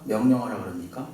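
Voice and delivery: ordinary talk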